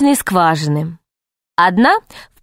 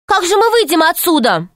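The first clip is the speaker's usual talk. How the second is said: alarmed cry; 8 semitones higher than usual. A narrator's reading. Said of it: "крикнула Алиса".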